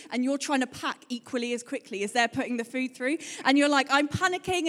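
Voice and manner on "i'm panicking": higher-pitched retold fluster; hammed-up flustered complaint; mock panic. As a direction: act out being stressed at the checkout. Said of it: "you're, like, just stressed".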